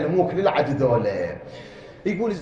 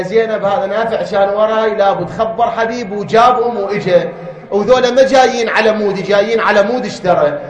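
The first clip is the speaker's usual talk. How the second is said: louder impassioned preaching; raised sermon voice; preaching at full force; 4 semitones higher, 9 dB louder than usual. Acted. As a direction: press the point to the listeners.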